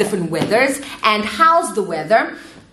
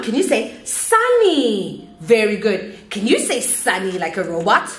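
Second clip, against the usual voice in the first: put-on animated, drawn-out kids' lesson voice; teacher to young children; 3 semitones higher, slower than usual. Shown as a demonstration. Teacher leading a weather game for children, animated. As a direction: sing out the words playfully to little learners.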